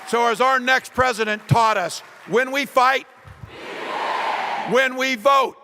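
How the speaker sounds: rally speech. Acted. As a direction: fire up the cheering crowd. Rally climax to the crowd.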